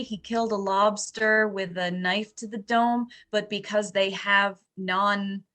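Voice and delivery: normal talking voice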